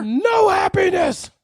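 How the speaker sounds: mock shout.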